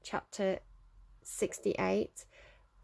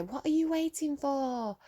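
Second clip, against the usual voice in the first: imitating a scream at the sky, pitched high; mock movie scream; 6 semitones higher than usual. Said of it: "spinning around in the road screaming at the sky".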